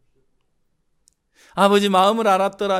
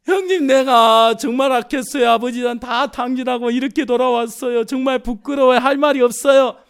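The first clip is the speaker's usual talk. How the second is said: remorseful confession; 5 semitones higher, 8 dB louder than usual. Acted. Younger son confessing to brother.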